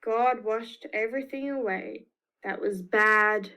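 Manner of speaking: plain reading voice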